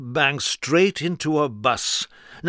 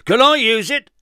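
very high, loud cut-in; eager interruption; 11 semitones higher, 8 dB louder than usual. Acted. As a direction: jump in eagerly. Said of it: "asked Mister Strong".

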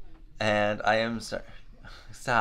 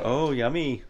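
ordinary talk, nothing marked

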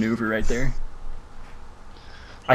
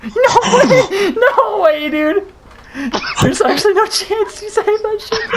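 laughing disbelief; slower than usual. Real, not acted. Unbelievable save, shouting with laughter.

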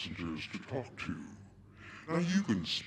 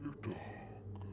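haunting question with a long pause, fading away; dream voice; 6 dB quieter than usual. Acted. Captain's words return in the narrator's sleep, haunting and fading.

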